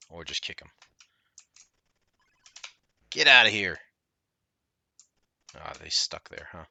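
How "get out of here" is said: shout. Real, not acted. Kicking ninja in game.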